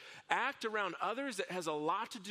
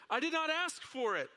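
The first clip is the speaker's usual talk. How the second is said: protesting; 8 semitones higher, 3 dB louder than usual. Acted. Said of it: "the man said".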